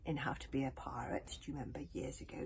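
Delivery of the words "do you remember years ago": usual speaking voice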